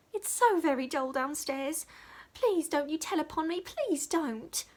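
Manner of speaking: put-on high, loud begging girl's voice; little maid pleading with Dick not to tell; pleading small servant. A narrator's reading. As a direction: beg him not to tell on you.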